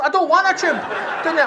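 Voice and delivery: ordinary talk, nothing marked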